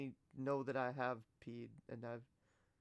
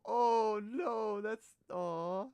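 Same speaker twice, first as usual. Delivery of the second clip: ordinary talk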